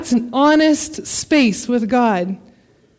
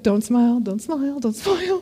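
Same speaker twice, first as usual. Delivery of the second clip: holding back a smile; 5 dB quieter than usual. Retold or imitated. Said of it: "that moment as a parent of".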